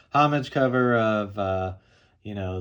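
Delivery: normal talking voice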